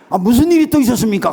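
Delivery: loud, high alarmed question; acted alarm. Pastor alarmed by the second call.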